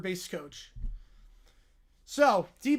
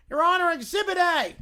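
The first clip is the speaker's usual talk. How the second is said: louder, higher courtroom lawyer; blue-haired lawyer; 7 semitones higher, 7 dB louder than usual. Acted. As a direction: present the evidence grandly.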